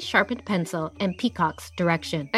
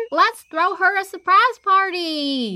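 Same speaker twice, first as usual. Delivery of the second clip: surprise party shout; 9 semitones higher than usual. Acted. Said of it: "And I was thinking".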